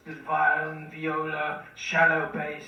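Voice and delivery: ordinary talk, nothing marked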